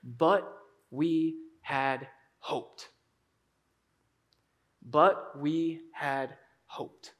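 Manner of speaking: slow, hushed, low dejection; disappointed. Acted. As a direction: let each word fall with lost hope. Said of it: "They are sad".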